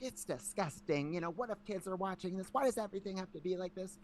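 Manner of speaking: high-pitched impression of an upset mother; disgusted mom. Imitating his outraged mom in argument.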